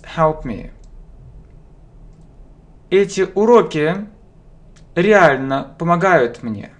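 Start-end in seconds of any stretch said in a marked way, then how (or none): none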